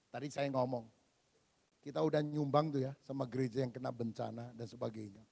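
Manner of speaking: normal talking voice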